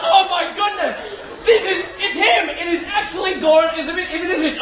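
hammed-up starstruck excitement, voice shooting up; starstruck gushing. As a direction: squeal in giddy disbelief at your hero. Retold gushing at meeting childhood hero.

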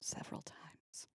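slow breathy whisper; whispered echo. Real, not acted. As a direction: whisper it back slowly. Echoed in a whisper.

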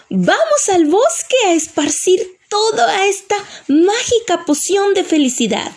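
high-pitched fairy rallying cry; rally the ogre with a high, lilting call; excited fairy. Acted. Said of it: "El hada le dice al ogro". (hada emocionada, voz muy aguda)

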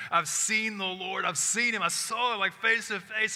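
Mary tells the disciples she has seen the Lord, breathless excitement; excited announcement. Acted. Mary to the disciples: breathless, joyful announcement.

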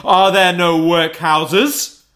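high, loud, slow mocking question; bitter retort. A narrator's reading. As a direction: throw his own words back at him. Spirit throws Scrooge's words back.

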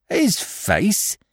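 high, incredulous echo; indignant Cockney crook. A narrator's reading. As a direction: throw it back high and affronted. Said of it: "cried Billy indignantly".

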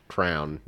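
"crown" as crayon in one syllable. Shown as a demonstration. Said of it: "some people say crown".

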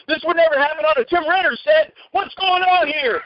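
high, heated retelling without full volume; mock outraged outburst. Actor interrupts film set prank, mock outraged. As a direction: act out a protest, slowing on the last question. Teller acts his staged outburst.

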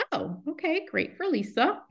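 high, caught-off-guard "oh okay"; imitating her startled past self; mock surprise. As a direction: pretend to be pleased but thrown. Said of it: "And I'm like".

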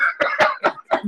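airy high giggle; breathless giggle; high wheezing laugh. Real, not acted.

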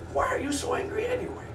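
squeaky. Pretend. Third silly retake of question.

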